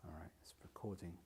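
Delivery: hushed whisper to self, fast. Real, not acted. Checking the recording is on, in a whisper. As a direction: murmur it under your breath as the mic starts.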